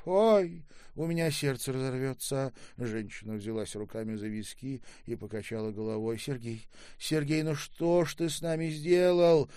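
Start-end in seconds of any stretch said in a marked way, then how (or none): 0.0-2.5 high, loud emotional outcry; overwhelmed lament. A narrator's reading. Mother clutching her temples at son's return, overwhelmed. 6.2-9.5 high, loud, building lament; anguished reproach. A narrator's reading. Mother asks returned son what he did, anguished.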